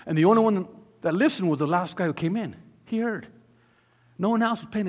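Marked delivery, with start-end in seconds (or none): none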